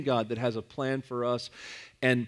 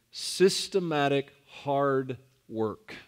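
normal talking voice

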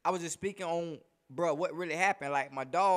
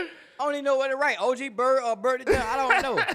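excited laughing disbelief; 7 semitones higher, 7 dB louder than usual. Real, not acted. Repeats line, laughing disbelief.

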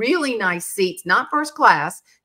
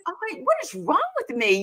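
self-reproach; 8 semitones higher, 4 dB quieter, faster than usual. Retold or imitated. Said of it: "I felt so crummy".

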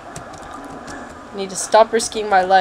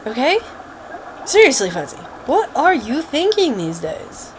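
ordinary delivery

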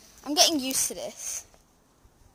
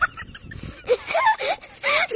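shrieking laugh; 5 dB louder than usual. Real, not acted. Friend mocks her looks.

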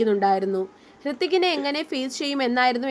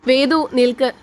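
a sudden louder call of her name, not a shout; calling out to stop; 8 dB louder than usual. A narrator's reading. Hrithik stopping Vedika at the orphanage gate, urgent call. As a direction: call her back before she goes, urgently.

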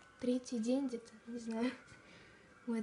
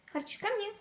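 talking to a dog; 9 semitones higher than usual. Real, not acted. Calling dog, high.

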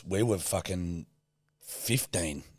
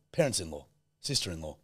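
usual speaking voice

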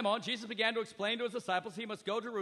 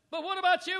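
higher, louder probing question; voicing Jesus; 10 semitones higher, 9 dB louder than usual. Jesus asking his disciples, louder searching question. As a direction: press the disciples with it.

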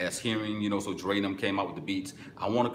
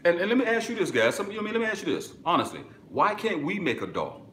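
excited; 10 semitones higher, 3 dB louder, faster than usual. Real, not acted. Excited new idea.

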